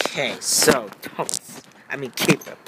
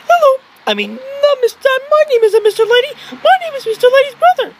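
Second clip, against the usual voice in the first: very high put-on toy voice; Mister Lady voice; 3 dB louder than usual. Mister Lady greets the viewers, very high. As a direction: squeak it like a tiny toy.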